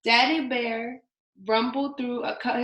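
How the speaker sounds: ordinary delivery, nothing marked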